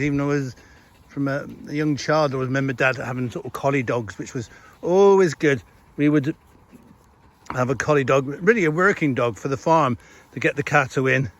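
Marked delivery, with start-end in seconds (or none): none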